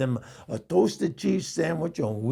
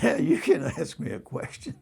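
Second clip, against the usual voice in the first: higher, a laugh pushing through; holding back laughter; 8 semitones higher than usual. Retold or imitated. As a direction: answer while stifling a laugh. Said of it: "I was holding back my laughter".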